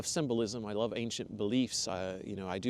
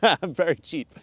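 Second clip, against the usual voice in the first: laughing; 7 semitones higher, 9 dB louder than usual. Real, not acted. Laughs at own cheap price.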